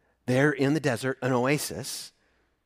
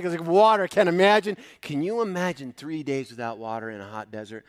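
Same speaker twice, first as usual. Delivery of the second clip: ordinary talk, nothing marked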